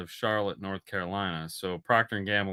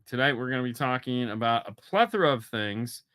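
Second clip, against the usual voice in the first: usual speaking voice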